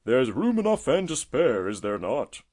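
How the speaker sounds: high, loud start, easing down; gaily. A narrator's reading. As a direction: boast cheerfully of the space. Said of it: "cried the tortoise gaily".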